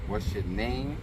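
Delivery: ordinary talk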